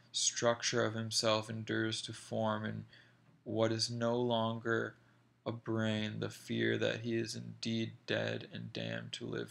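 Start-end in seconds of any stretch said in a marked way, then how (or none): none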